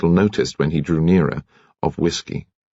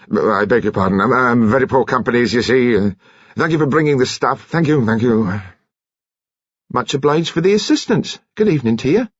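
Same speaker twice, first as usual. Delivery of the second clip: put-on higher, hurried voice of an awkward old sailor; awkward commander; 5 semitones higher than usual. Commander fumbles a goodbye to the nurse, awkward and hurried. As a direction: stumble through thanks with exquisite awkwardness.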